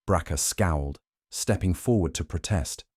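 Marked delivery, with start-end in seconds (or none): none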